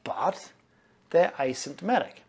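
usual speaking voice

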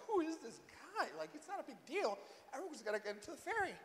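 very soft, hushed aside; murmuring onlookers. Retold or imitated. Bystanders commenting on the angry man, murmuring. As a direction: murmur it under the breath, puzzled. Onlookers muttering about him.

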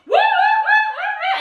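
very high, loud cheering; shrieking laughing woo-hoos; whooping kids. Retold or imitated. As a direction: cheer like kids let loose to paint. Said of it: "now they're like".

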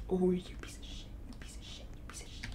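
barely audible repeated whisper; whispered cursing. Real, not acted. Streamer cursing a game character repeatedly, hushed whisper. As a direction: curse secretly under your breath.

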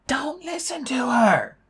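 slow, high whispered shout; Annabeth shouting. A narrator's reading. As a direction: call urgently as if from far away. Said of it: "Annabeth's voice shouted".

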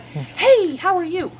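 put-on a dog greeting an old pal; voicing the dog greeting a known dog, eager; excited dog greeting. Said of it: "after she gets to know the dogs, she's like".

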